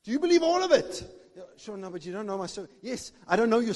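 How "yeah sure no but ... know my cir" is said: much softer, mumbled objection; a doubter muttering back to the preacher; mock doubter. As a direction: play the unconvinced listener under your breath. Voicing a doubting listener.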